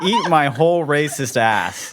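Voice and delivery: slow, punchy joke holler; mock abusive shout. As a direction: shout a crude insult for laughs. Mock abusive email reply.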